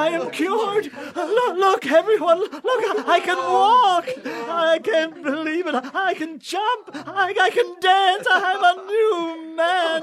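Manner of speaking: overjoyed, laughing through the words; joyful laughter. Acted. Cripple just healed, dancing.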